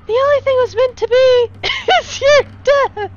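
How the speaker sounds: high menacing line lost to laughter; high villain threat, giggling through it; villain line cracking up. Acted. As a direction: try to stay menacing but laugh.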